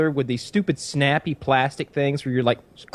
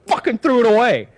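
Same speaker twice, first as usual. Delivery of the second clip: mad; 9 semitones higher, 5 dB louder than usual. Retold or imitated. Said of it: "getting madder and madder".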